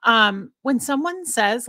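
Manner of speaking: ordinary talk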